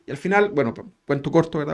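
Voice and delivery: ordinary delivery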